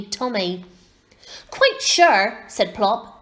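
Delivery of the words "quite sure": huffy. A narrator's reading. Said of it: "drawing himself up tall".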